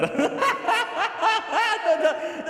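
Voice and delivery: squealing high-pitched laugh; high giggle fit. Real, not acted. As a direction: burst into helpless giggles. Cracks up at own voice.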